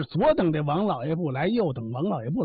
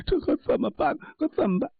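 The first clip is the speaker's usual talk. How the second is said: fake crying; 8 semitones higher than usual. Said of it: "一再的哭".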